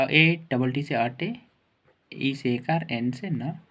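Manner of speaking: ordinary delivery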